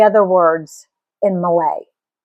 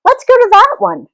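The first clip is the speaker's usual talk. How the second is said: excited urging; 12 semitones higher, 4 dB louder than usual. Acted. Kid urges family to empty car.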